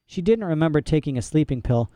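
ordinary delivery, nothing marked

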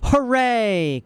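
high-pitched cheer from the audience; drawn-out, delighted hooray; jubilant cry. A narrator's reading. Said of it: "cried a voice from the audience".

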